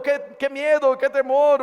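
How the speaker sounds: high, loud frightened cry; fearful cry. Shown as a demonstration. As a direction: cry out in terror. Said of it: "una voz de miedo". (grito de miedo agudo)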